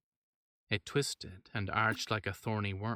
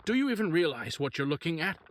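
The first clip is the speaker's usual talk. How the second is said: loud, higher outraged no; doctor refusing the mayor's order, loud and outraged; indignant refusal; 7 semitones higher, 5 dB louder than usual. Acted.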